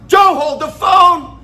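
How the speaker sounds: calling out. Retold or imitated. Club worker stopping a man trailing a customer, calling out. Calls out to stop Joe.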